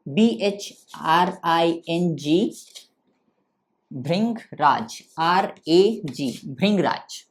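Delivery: slow, letter-by-letter spelling. Shown as a demonstration. Teacher spells the herb's Hindi name for students, spelling out. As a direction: spell it out slowly with gaps between letters.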